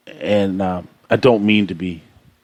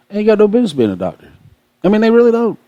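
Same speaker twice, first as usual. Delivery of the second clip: much higher and fast, exasperated; exasperated high repeat; 12 semitones higher, much faster than usual. Real, not acted. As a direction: push it way up in disbelief, quickly. Repeats complaint about doctors, pitched up.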